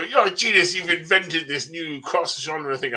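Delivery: high, loud burst of mock awe; gushing fan. Author mimicking readers who don't know the genre, gushing. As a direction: imitate a fan hailing a great inventor. Voicing gushing fans again.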